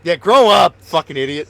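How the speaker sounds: loud mock-angry outburst, easing off; mock scolding. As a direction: yell at kids like a grumpy grown-up.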